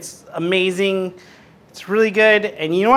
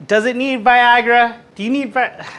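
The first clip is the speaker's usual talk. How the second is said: raised, higher-pitched scolding questions; angry taunting; 5 semitones higher, 3 dB louder than usual. Real, not acted. Host berating the faulty camera, angry taunting.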